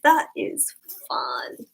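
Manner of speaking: low, slow whispered deadpan; deadpan sarcasm. Retold or imitated. To her friends about the felon, slow sarcastic whisper. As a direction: drawl it flat and sarcastic.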